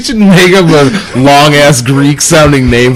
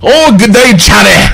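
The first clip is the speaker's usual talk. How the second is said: high-pitched Scottish attempt that slips toward Australian; botched Scottish accent; 7 semitones higher, 4 dB louder than usual. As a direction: badly fake a Scottish accent.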